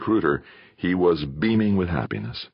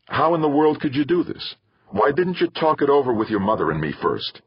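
upset father; 9 semitones higher than usual. Acted. Father confronts son over news.

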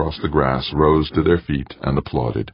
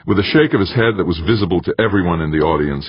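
ordinary delivery, nothing marked

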